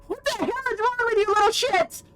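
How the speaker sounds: high, loud, raging and close to tears; furious tearful girl. Acted. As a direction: scream at the cruel fans to defend her idol. Reese swings a stand at fans.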